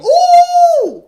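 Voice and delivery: high loud gossip squeal; excited squeal. Real, not acted. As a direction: squeal at the juicy news. Reacting to gossip topic.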